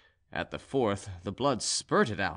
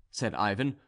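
usual speaking voice

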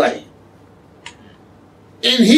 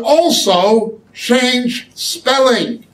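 slow emphasis; 3 semitones higher, much slower than usual. Shown as a demonstration. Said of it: "teacher slows for emphasis".

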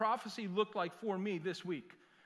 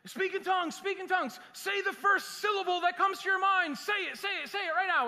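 high, loud, hectoring preacher imitation; mock frenzied shouting; 11 semitones higher, 6 dB louder than usual. Pastor imitates online preacher pushing tongues, high frantic shouting. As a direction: badger them at a high-pitched shout, mocking. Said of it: "This one guy was saying".

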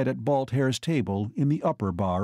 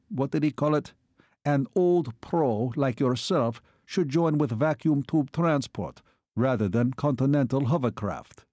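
formal, official foreign officer voice; Hungarian colonel; 3 semitones higher than usual. Acted. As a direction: speak as a courteous military attaché. Hungarian colonel explains to Joe.

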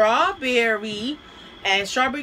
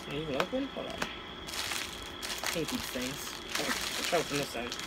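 muttering under breath; 7 semitones lower, 11 dB quieter than usual. Real, not acted. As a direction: mutter it to yourself while fiddling. Struggling to open the box.

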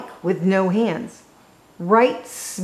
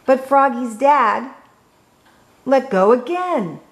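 ordinary delivery, nothing marked

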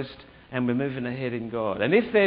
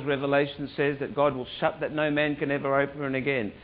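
ordinary talk

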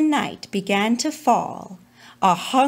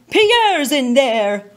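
father's loud reply; 3 semitones higher, 6 dB louder, slower than usual. Acted. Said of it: "His father said".

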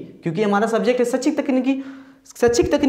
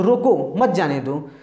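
"रोको", short stop, "मत जाने दो"; spoken command split "रोको, मत जाने दो"; pause after रोको; 4 semitones lower than usual. Shown as a demonstration. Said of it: "मुंह से बोलेंगे तो".

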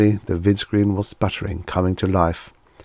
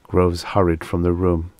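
usual speaking voice

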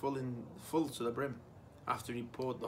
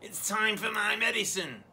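put-on higher, nagging old granny call; awful Grandma voice; 7 semitones higher, 5 dB louder than usual. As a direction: whine it like a demanding grandma. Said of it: "came the awful voice from the next room".

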